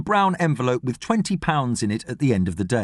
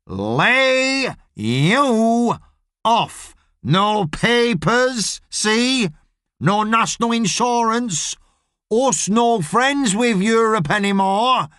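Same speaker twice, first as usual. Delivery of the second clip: high, very slow talk to foreigners; over-enunciating boss; 8 semitones higher, much slower than usual. Acted. Boss explaining layoffs to Latvian workers, slowly enunciating. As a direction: drag out each word for poor English.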